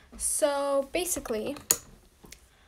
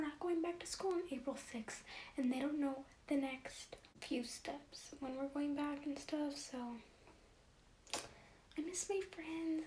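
whispering; 10 dB quieter than usual. Real, not acted. Whispered vlog update.

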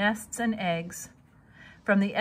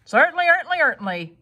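pompous gander; 6 semitones higher, 7 dB louder, much faster than usual. A narrator's reading.

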